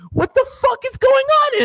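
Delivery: real disbelief at a dark scene; incredulous squeal. Real, not acted. Outraged at the unlit scene.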